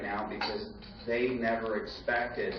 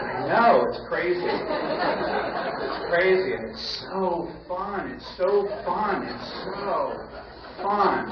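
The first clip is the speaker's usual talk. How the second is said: high, delighted and giddy; gleeful; 7 semitones higher, 4 dB louder, slower than usual. Retold or imitated. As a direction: gloat happily to your wife. Gleeful retold reply to wife.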